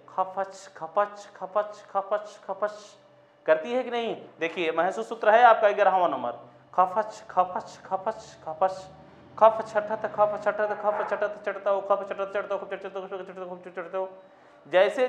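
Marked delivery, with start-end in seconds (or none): 0.1-2.7 soft rhythmic chug of a train starting up; train chugging. Shown as a demonstration. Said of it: "train की आवाज़ कैसी होती है". 6.7-14.1 chugging that quickens and fades away; train speeding up, fading. Shown as a demonstration. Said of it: "धीरे धीरे बोलते चली जाती है".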